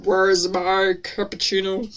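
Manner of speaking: low, slow, drawn-out impression; diva doctor. Doctor demanding coffee down the hall, slow diva voice. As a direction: drag it out low like a haughty diva. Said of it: "what a diva".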